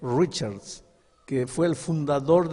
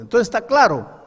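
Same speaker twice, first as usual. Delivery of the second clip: loud, high demand for an answer; stern mother; 9 semitones higher, 10 dB louder than usual. Acted. Mother pressing her son on the deal, loud and stern. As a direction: bark the question like a strict mother.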